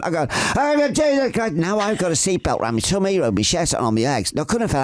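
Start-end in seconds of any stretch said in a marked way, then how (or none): 0.3-1.5 high-pitched mock alarm; acted-out fright at a much higher pitch; retold panic. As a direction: re-enact a panicked cry, pitched way up. Acts out panic during aerobatics.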